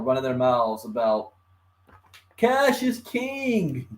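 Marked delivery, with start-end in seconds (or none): 2.4-3.9 high, slow mocking sneer. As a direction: parody the smug critic. Mocking Bitcoin critics.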